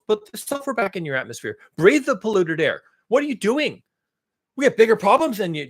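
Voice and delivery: mock-indignant scolding; sarcastic outrage. Ranting as [name] at polluters cutting sulfur, sarcastic outrage. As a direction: order them about with exaggerated, sarcastic indignation. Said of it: "I'm being fucking sarcastic".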